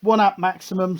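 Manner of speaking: ordinary talk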